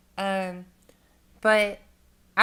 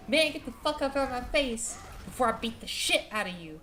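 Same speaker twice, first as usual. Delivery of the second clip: higher, tense re-enactment without shouting; retold angry threat; 4 semitones higher than usual. Storyteller threatening the drunk shover, high angry re-enactment. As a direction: act out a threat to back off.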